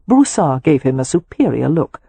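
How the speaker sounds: usual speaking voice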